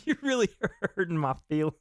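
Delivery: breaking into a laugh mid-sentence. Real, not acted. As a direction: laugh through the words. Laughs while complaining about teasing.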